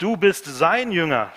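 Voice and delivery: slow, loud accusing shout, pitch up; angry shout. Retold or imitated. Pharisees yelling at the healed man, slow and loud. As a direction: hurl the accusation at him. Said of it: "schrien sie ihn an".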